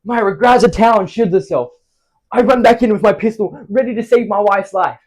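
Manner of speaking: loud, high, urgent action telling; frantic storytelling. Acted. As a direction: relive the panic at full voice. Acting out the intruder panic.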